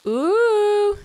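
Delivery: high, loud hopeful ooh; thrilled at a possible catch; excited ooh. Real, not acted. Hopes it's the crimson fish.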